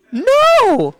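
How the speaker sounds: high, loud, drawn-out "no"; punchy comic outburst; emphatic no. Acted. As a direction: blurt out a loud, high-pitched refusal. Answers own absurd test question.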